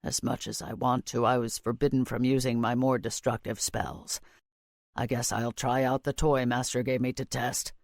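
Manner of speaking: low, soft, calm male voice; low Rockruss voice. A narrator's reading. Rockruss character voice.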